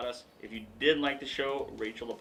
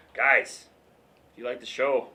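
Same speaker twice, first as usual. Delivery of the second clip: ordinary talk, nothing marked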